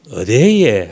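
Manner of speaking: lilting low Gyeongsang denial; Gyeongsang dialect. Shown as a demonstration. Preacher shows congregation the southeastern version, Gyeongsang accent. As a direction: say it as a Gyeongsang local would. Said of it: "경상도 버전을 하면 뭘까요?".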